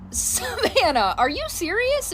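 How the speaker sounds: name drawn out slowly, then a high questioning rise; incredulous. Retold or imitated. Mom catching daughter's faked illness, drawn-out incredulous. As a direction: disbelief at the sudden recovery. Mom reacts to sudden recovery.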